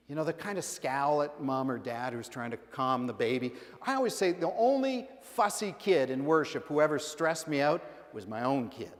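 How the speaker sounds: usual speaking voice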